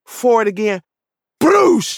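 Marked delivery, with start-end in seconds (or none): 1.4-2.0 high, loud burst of "broosh"; imitated crash noise. Storyteller mimicking the shower bar crashing down, imitated crash noise. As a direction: punch out a crashing whoosh. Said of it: "That's all you heard was".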